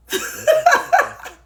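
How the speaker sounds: high, loud real laugh; burst of laughter. Real, not acted. Admitting that life gets boring, loud laugh. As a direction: crack up in a high cackling burst.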